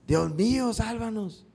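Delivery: súplica alta y rota al final; clamor de auxilio. Shown as a demonstration. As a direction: grita pidiendo que Dios te salve. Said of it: "alguien quiere hablar así en voz alta". (ruego de ayuda en apuros)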